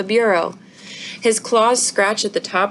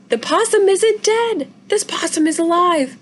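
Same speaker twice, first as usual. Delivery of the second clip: excited stifled scream; 10 semitones higher than usual. Acted. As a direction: hold back a scream of joy. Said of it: "Izzy starts to scream, but she stops herself".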